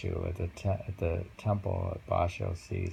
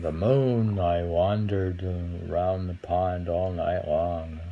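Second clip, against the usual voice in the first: slow, weighty reading of a haiku, louder; poem recital; 5 dB louder, slower than usual. Acted. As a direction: lean into each image.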